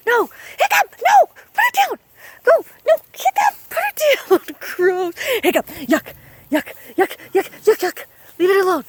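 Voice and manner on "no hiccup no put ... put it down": very high, loud commanding yells; shouting at the dog. Real, not acted. Mom yelling at Hiccup to drop the frog, loud and high.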